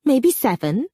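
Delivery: annoyed. A narrator's reading. Daughter tired of Andres's questions, mildly annoyed. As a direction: let her impatience slip out. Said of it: "her annoyance coming out".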